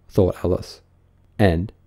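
ordinary delivery

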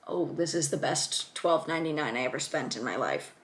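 normal talking voice

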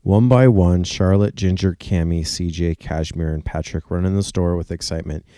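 lively storybook narration; animated picture-book reading; reading to children. Acted. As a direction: read it out to little kids. Reads children's story aloud.

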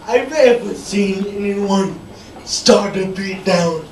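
ordinary talk, nothing marked

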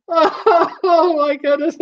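high, drawn-out words through laughter; helpless with laughter; real laughing fit. Real, not acted. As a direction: squeal it out slowly while cracking up. Helpless laughing fit, named later.

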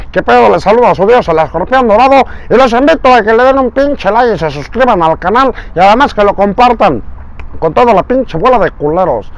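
brash show host swagger; Escorpión Dorado voice. Retold or imitated. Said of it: "la voz del escorpión dorado". (voz aguda y fanfarrona de conductor)

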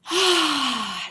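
big breath let out in a long sigh; exaggerated calming sigh; deep breath. Reader acting out Betty calming down, deep breath. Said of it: "she took a deep breath".

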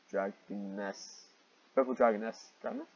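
ordinary talk

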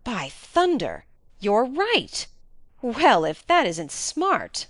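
slow, drawn-out delighted astonishment; amazed exclamation. A narrator's reading. Client seeing the detective's trick, amazed and emphatic. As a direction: exclaim loudly with big rises and falls. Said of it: "cried Sir Henry".

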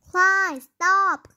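high, loud yell to stop; sister yelling. A narrator's reading. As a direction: shout to stop him bouncing. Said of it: "Claudia yelled".

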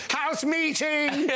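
high, drawn-out shouted call; house-meeting shout, slow and high; Rick from The Young Ones. Retold or imitated. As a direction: bellow it slowly like a ranting student. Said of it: "a bit like Rick in The Young Ones".

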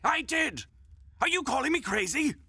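high offended cry; indignant. Acted. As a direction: bristle and fire back. Witness defends himself indignantly.

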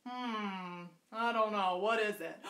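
a hint of a drowsy bear; lightly voiced bear, unsure; sleepy bear. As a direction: Bear answers Rabbit, half asleep. Sleepy Bear answers Rabbit.

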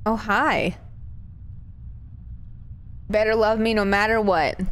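usual speaking voice

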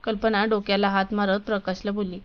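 plain reading voice

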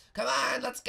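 put-on high boyish holler; calling out. Bart calls out to go.

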